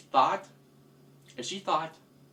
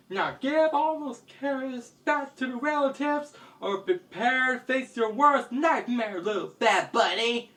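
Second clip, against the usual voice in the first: high-pitched heroic ultimatum; stern hero's threat; 9 semitones higher than usual. Acted. Hero orders thief to return carrots, high-voiced threat. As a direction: demand it in a raised, high voice.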